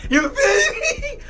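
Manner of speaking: very high, slow, drawn-out squeal; giddy squeal. Real, not acted. Man telling viewers he likes it like that, giddy squeal. Delighted she matches his energy.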